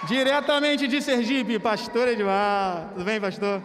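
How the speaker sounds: loud, slow showman intro; booming presenter voice through a mask; masked announcer. Pretend. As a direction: announce the guest like a stage host. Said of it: "Vou botar a máscara".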